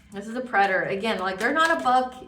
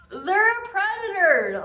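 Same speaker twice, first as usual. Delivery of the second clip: high, slow, loud warning; high-pitched warning; 10 semitones higher, 5 dB louder, slower than usual. Real, not acted. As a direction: stretch it out way up high.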